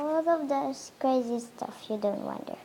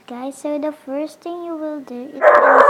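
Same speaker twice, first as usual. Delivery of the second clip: raised voice over noise; loud; 4 semitones higher, 15 dB louder than usual. Real, not acted. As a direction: talk over the dog. Shouting over the barking dog.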